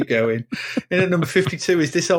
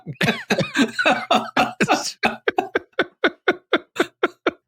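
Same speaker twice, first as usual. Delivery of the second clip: long high-pitched laughing fit; helpless laughter. Real, not acted. Cracking up at accidental rude rhyming slang, helpless laughter. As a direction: crack up completely.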